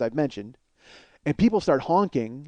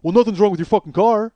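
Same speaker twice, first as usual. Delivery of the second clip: raised, loud argumentative comeback; angry retort; 5 semitones higher, 6 dB louder than usual. Retold or imitated. Passenger argues with the stopped driver, angry. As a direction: throw it back at him heatedly. Retells passenger arguing back.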